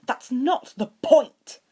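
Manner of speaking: high, loud, slow insistence; insisting. A narrator's reading. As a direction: dig in and insist. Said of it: "Draco insisted".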